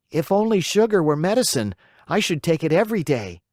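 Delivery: put-on high, petulant puppet child refusing; a spoiled boy haggling over medicine; stubborn puppet boy. A narrator's reading. Pinocchio voiced in story.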